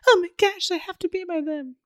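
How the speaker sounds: high, dramatic desperate voice; mock clingy passenger. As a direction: gush it like you can't bear to be apart. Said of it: "other ones will be like".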